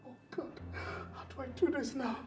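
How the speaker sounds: panicked whisper. Acted. Husband panicking alone.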